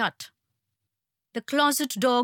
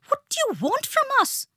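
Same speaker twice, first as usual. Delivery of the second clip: much higher, screeching plea; rising to a screech; faster than usual. A narrator's reading. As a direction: let the voice climb into a terrified screech. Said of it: "voice was rising to a screech".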